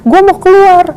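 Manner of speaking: imitating a man's impatient yell; retold shout, pitched well up; teriakan memanggil. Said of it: "teriak-teriakan". (menirukan orang berteriak)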